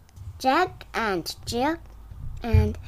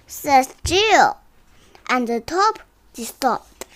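sing-song storybook reading; reading to a toddler; 2 semitones higher, 6 dB louder than usual. Acted. Child reads picture book aloud.

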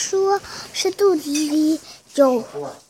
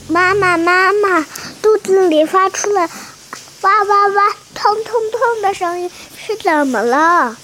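put-on higher childlike calling; child voice; 4 semitones higher than usual. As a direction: call to mama like a worried kid. Child calls to mother.

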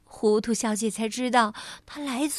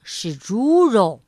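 slow, louder, firm correction of 猪肉; correcting; 6 dB louder, slower than usual. Acted. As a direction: stress 猪肉 to set her straight. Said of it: "肉店老板立刻更正".

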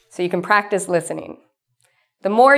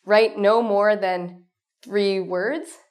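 slow, deliberate instruction read; much slower than usual. Shown as a demonstration. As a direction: read each word of the instruction slowly and clearly. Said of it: "If you see something like".

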